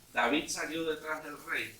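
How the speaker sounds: ordinary talk, nothing marked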